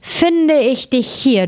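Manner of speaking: high, drawn-out "found you"; mild triumphant discovery. Acted. Hunter finds the old wolf in bed, slow high exclamation of discovery.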